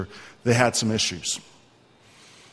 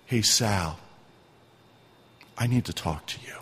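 gentle; 8 semitones lower, 4 dB quieter than usual. Retold or imitated. Jesus gently speaking to him.

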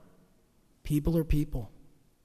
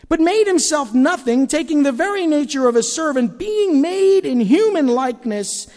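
higher, louder preaching; full-force preaching; 6 semitones higher, 6 dB louder than usual. Acted. Pastor preaching Philippians to the church, high and loud. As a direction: proclaim the scripture with passion.

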